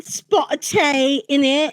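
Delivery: exaggerated British caricature; mock British accent. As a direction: stretch it out in a joke British accent. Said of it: "Why are you faking a British accent".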